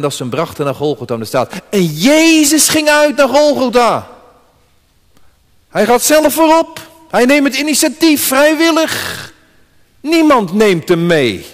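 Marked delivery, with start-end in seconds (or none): 1.7-11.5 raised preacher's proclamation; preaching climax. Real, not acted. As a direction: ring it out to the congregation.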